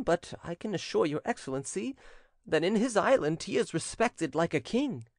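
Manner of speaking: high-pitched voice of an anxious servant; cowed servant, pleading. A narrator's reading. Servant telling his master about the girl, anxious. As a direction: answer your master anxiously, pitched well up.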